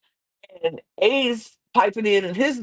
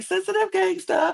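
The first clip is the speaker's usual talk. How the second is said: mock squeal; 14 semitones higher than usual. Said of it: "everybody was like".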